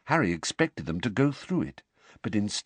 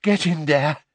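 panting; 6 semitones higher, 5 dB louder than usual. A narrator's reading. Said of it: "he panted".

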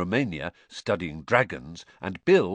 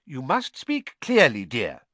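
Mrs Weasley, fussing; 4 semitones higher, 5 dB louder than usual. A narrator's reading. Mrs Weasley instructing Harry.

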